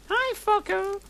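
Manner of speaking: very high mock sweet greeting; high girly greeting. Ebony greeting Trelawney in a high girly voice. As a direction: chirp it up high and sassy. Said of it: "I said".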